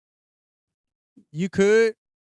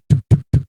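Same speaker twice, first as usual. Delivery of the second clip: imitated thumping; 5 semitones lower, 8 dB louder than usual. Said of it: "I could hear the motherfucker just do".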